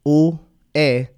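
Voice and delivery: letters spelled out one by one, very slow; spelled slowly. Shown as a demonstration. Guest spelling his surname for the host, very slowly. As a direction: drag each letter out as he spells his name. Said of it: "lo deletreé".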